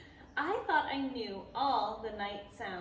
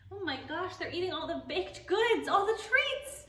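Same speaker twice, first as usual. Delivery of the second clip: higher, louder surprised exclaim; mock shock; 8 semitones higher, 5 dB louder than usual. Real, not acted. Reader to kids, amazed the bear eats all the treats. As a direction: gasp at the naughty bear.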